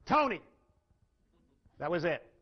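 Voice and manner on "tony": a blunt, loud one-word "Tony."; no-nonsense caller, all business; curt. Retold or imitated. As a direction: bark the name and nothing else. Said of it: "all he says to her is this".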